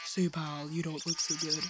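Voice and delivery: low, rough character voice; gravelly game character warning; grating voice. Acted. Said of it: "Sorry for my terrible voice acting".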